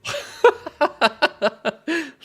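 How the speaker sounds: high, loud burst of laughter; big laugh. Real, not acted. Streamer cracks up at the bot's French accent. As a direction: crack up loudly.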